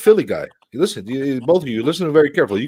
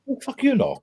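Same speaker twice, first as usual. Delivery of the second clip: New Yorkerish retort; 10 semitones higher, 7 dB quieter than usual. Retold or imitated. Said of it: "Did you really say it like that?".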